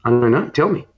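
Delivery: usual speaking voice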